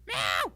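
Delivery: sudden loud cat yowl; imitated cat meow. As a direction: meow abruptly in someone's face. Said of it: "just goes".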